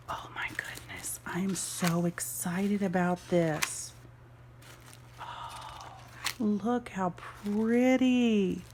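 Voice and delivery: slow whispered delight; real excited whisper; awed whisper. Real, not acted. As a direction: breathe it out in awe. Whispered awe over journal.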